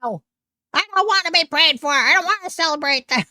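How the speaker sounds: high, fast mocking imitation of her refusal; mock screecher. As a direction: parody her snapping a refusal. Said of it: "Would you invite her?".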